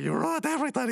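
high indignant cartoon wail; mock accusation. Birthday kid blaming his brother, high mock outrage. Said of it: "And he's like".

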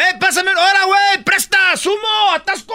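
high-pitched, loud barked yells; workers shouting on site. Acted. Worker yelling for tools to his crew, shouting. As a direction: call out over construction noise.

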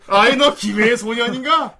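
playful put-on formality; imitated friend's speech style. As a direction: stretch out a stilted, pompous query. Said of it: "그러니까 환희 말투".

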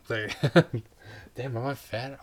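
breathless, panting between words; gasping for air mid-sentence; out of breath. Real, not acted. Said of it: "I'm running out of breath from talking like this".

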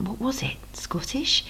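hushed thought; inner whisper. Acted. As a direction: whisper the guess to yourself. Sarah's inner wondering.

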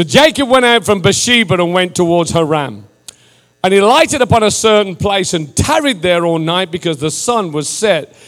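declamatory Bible reading with stretched key words; scripture reading. Real, not acted. Preacher reads Genesis from the King James, declamatory delivery. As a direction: proclaim the passage in measured, emphatic phrases.

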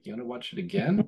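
high, mock-incredulous question to a child; amused dad.

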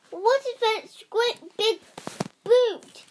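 high, loud alarmed cry; frightened mother. Acted. Mother crying out at the giant's boot. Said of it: "she cried".

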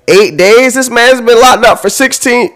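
high, loud indignant outcry; outraged shout of disbelief. Real, not acted. Host reacting to her eight days, outraged.

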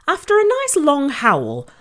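ordinary delivery, nothing marked

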